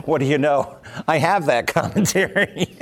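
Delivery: amused, laughing through the words; laughing realization. Real, not acted. Telling listeners he owned the book all along, laughing. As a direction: break into a laugh as you say it.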